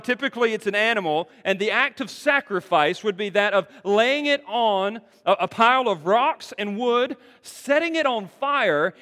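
loud, high, driving sermon delivery; full-force preaching. Acted. Preacher explaining burnt offerings to the congregation, loud and driving.

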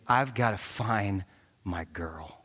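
slow whispered vow to himself; determined whisper. Retold or imitated. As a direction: breathe it out, fiercely resolved. Inner resolve, father searching.

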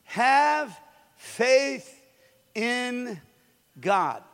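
each word spaced and weighty; preacher reading Jesus' words; slow solemn pronouncement. Acted. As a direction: lay down Have faith in God slowly. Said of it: "Jesus answered and said".

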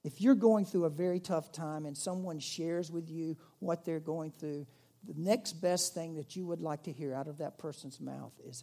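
usual speaking voice

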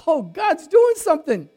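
imitating a woman grinning with delight; knowing, delighted, said with a smile; smiling woman. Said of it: "she got a smile on her face".

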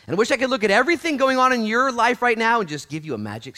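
very loud, high-pitched proclamation; shouted preaching. Real, not acted. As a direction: shout the story to the congregation.

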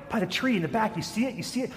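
mother gently steering the kids to the window, mildly voiced; lightly voiced mother coaxing. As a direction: lightly coax the kids to look.

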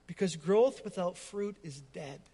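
ordinary delivery

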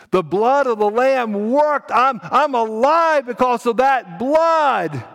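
high, loud, slow cry; exultant proclamation. Acted. Brian telling parents the blood saved him, exultant. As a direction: shout it out, stretching each key word.

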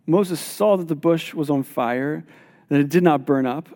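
usual speaking voice

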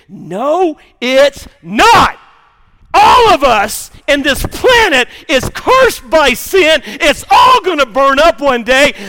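loud, high preacher's shout, slowing on the key words; preaching at full force. Acted. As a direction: thunder it back at the doubters.

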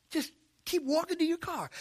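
hushed inner nagging; inner self-talk. Retold or imitated. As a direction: urge yourself on under your breath. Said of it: "I'm talking to myself on the inside".

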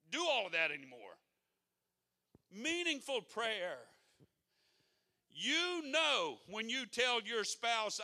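loud, high preaching; impassioned sermon; preaching at full force. Acted. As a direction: hammer it from the pulpit.

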